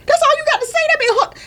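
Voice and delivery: high, outraged that's-all voice; indignant disbelief. Retold or imitated. To her chief who just shrugged it off, high indignant disbelief. Reacting to chief's calm answer.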